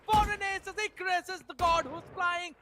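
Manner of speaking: excited shout. Real, not acted. Caster calls the ace.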